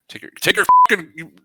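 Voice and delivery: high, fast hammed-up yelling; mock angry outburst. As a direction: blow up in a fake rage. Voicing character getting angry.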